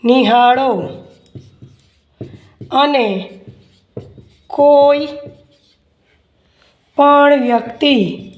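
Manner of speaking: word by word with long pauses; slow dictation. Acted. Teacher dictating an answer to students, slow dictation. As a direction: dictate slowly, leaving time to write.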